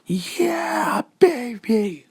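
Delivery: high, squealed celebration; drawn-out falsetto cheer of triumph; excited cheer. Acted. Izuku celebrating getting into U A, high excited cheer.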